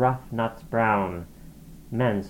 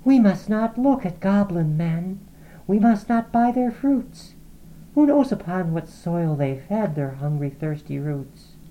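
put-on higher young girl's voice, warning; Laura, anxious; 8 semitones higher than usual. A narrator's reading. Laura warning Lizzie about goblin men, higher anxious voice. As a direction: hush your sister with a higher urgent warning. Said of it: "Laura said".

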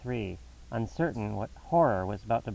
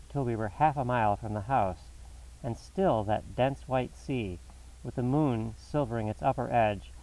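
plain reading voice

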